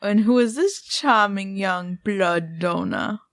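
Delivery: slow, imitated Transylvanian vampire; hammed-up Transylvanian accent, drawing out the words; vampire dad. Co-host redoing the vampire dad's introduction from Hocus Pocus in a mock Transylvanian accent. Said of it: "the dad's a vampire and he introduces her".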